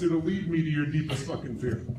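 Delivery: ordinary talk, nothing marked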